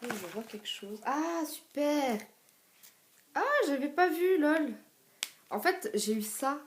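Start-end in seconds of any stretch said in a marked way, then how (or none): 1.0-2.2 high, drawn-out happy exclamation; real delight at a find; delighted surprise. Real, not acted. Delighted on finding something in the package. 3.3-4.7 high, loud exclamation of discovery; real amused surprise; happy surprise. Real, not acted. Surprised by something she had missed.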